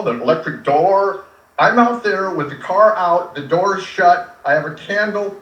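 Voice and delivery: usual speaking voice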